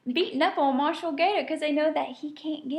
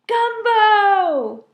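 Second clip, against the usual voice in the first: hot; 9 semitones higher, 11 dB louder than usual. A narrator's reading. Gator boiling over.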